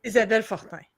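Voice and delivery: Isabelle Fortin in French Canadian; French Canadian accent. Shown as a demonstration. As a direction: say the name as a French Canadian would. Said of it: "as a French Canadian".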